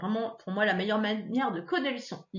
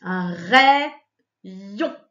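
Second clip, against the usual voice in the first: "rayon" split into two long, loud syllables; syllabes détachées; 3 semitones lower, 9 dB louder than usual. Shown as a demonstration. Teacher splits word into syllables. (« rayon » en syllabes bien détachées)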